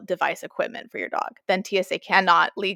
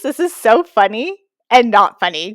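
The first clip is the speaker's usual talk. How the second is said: ordinary talk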